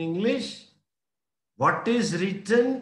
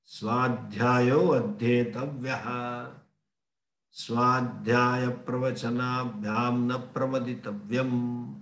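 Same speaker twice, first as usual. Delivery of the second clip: slow, low Vedic chant; measured scriptural recital on a low level pitch; Sanskrit recitation; 6 semitones lower, 3 dB quieter, slower than usual. Shown as a demonstration. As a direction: intone it evenly with long vowels.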